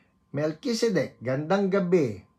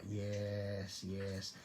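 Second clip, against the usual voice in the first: normal talking voice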